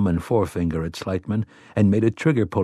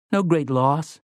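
ordinary delivery, nothing marked

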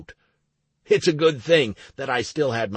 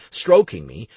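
plain reading voice

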